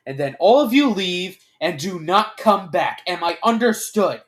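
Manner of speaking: loud, firm orders; commanding. A narrator's reading. Aaron lays down the rules to the group, commanding. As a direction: lay down the rules forcefully.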